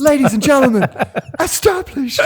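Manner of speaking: high, hammed-up stage announcer; mock announcer. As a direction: introduce the band like a game-show host. Announcing band name.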